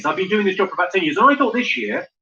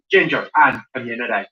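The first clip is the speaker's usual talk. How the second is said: lower-pitched mock Belfast; Belfast accent; 6 semitones lower than usual. Shown as a demonstration. Comedian leads everyone to try Belfast, in accent. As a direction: model a Belfast accent for the crowd. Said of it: "Let's go Belfast".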